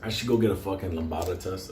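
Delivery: ordinary talk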